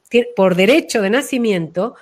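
ordinary talk

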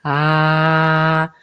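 low resonant Ah; heart-centred ah. Shown as a demonstration. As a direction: hand on chest, sound a deep Ah. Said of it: "ponerse la mano y de sentir".

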